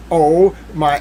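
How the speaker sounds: normal talking voice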